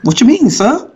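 high, laughing put-on servile voice; mock subservient. Man mocking a cop with exaggerated groveling, high and laughing.

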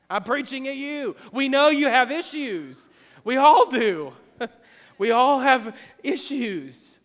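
forceful sermon delivery, high and loud; impassioned preaching. Real, not acted. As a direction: preach at the congregation, stretching the key words.

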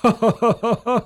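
light laugh rising at the end; father chuckling fondly; amused chuckle. Acted. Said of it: "Papa Wassermann lacht".

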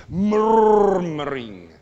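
loud, long rolling "MURRR-muring"; murmuring noise. Shown as a demonstration. Preacher showing an ugly grumbling noise, drawn-out rumble. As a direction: rumble through the first syllable of "murmuring", very slowly. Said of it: "It's not a nice sound".

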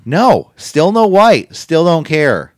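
mock outrage. Mock outrage about wrestler's attire.

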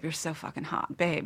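usual speaking voice

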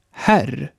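Herr as Swedish här, wide open vowel; very open E before R. Shown as a demonstration. Teacher models Herr for learners, very open E. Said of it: "Noch offener wird das E".

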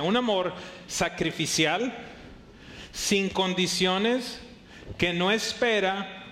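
drawn out word by word; slow emphatic preaching. Real, not acted. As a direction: lay each phrase down slowly for weight. Preacher slows to stress definition. (predicando despacio, palabra por palabra)

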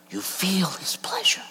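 hushed awed whisper; whispered promise. Acted. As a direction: let it fall to a whisper. Repeated, whispered again.

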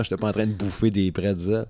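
ordinary delivery

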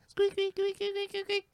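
tiny squeaky voice, very high; high-pitched mouse squeaks. Retold or imitated. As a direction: squeak like a terrified little mouse. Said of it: "like this high-pitched, cute little".

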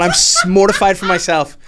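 normal talking voice

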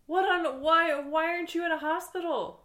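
loud, high, incredulous questioning; alarmed disbelief. Acted. Crew member to injured host who skipped hospital, alarmed disbelief. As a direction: splutter it in high-pitched alarm.

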